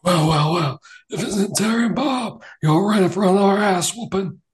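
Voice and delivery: high, loud taunting cowboy villain; gloating villain. Acted. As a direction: sneer it out like a swaggering bad guy. Villain Austin enters the bar.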